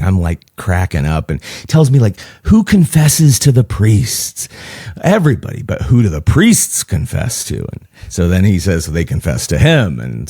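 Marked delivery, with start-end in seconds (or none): 2.4-7.6 put-on light Greek accent, broken English; old Cretan man with a Greek accent. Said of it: "my best Greek impression".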